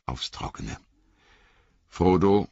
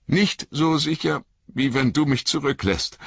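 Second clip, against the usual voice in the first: high, hurt, shivering reproach; a wet hobbit stung by his master; Sam, cold and soaked, reproaching Frodo in a high voice; 11 semitones higher than usual. Acted.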